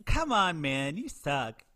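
high, loud mock outrage; indignant outburst. [name] scolds Brad in high, loud mock outrage. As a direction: scold him in hammed-up disbelief. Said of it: "and it's like".

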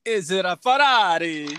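loud, high, drawn-out Italian flourish; mock Italian accent. Guesser asks if it is a Ferrari, hammed-up Italian accent.

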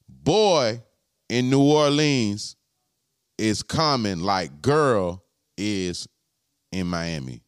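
slow, deliberate spelling-out; real slow. Shown as a demonstration. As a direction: drag every word so nobody misses the code. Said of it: "let me say that real slow".

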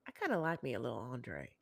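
low, soft come-on purr; lightly voiced flirt; sultry mock flirt. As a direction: tease it like a flirty aside. Imitates Angela flirting.

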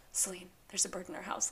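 whispered urgent report. Retold or imitated. Husband phoning his wife about the bird, whispered. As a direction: hush it like a secret alarm.

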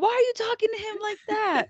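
high, loud mock protest; put-on high sibling complaint; indignant older kid. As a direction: imitate an affronted teenager. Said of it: "And the older one's like".

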